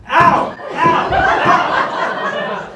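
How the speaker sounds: hammed-up cries of pain; mock pained ow. Servant beaten by the Frenchman, mock pained yelps.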